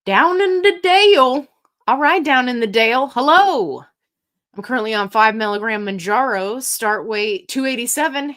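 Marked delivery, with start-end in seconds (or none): 0.1-3.8 loud, high, whooping hello; calling out greeting. Real, not acted. Greeting Down in the Dale on a livestream, loud excited call-out. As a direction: holler it out to welcome someone. Greeting a viewer by screen name.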